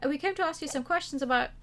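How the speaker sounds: normal talking voice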